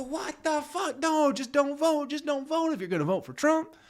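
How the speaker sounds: very high squealing mock outrage; mocking a friend horrified by a Trump vote; shocked outraged voter. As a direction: shriek it like a scandalized friend. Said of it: "then they'll go".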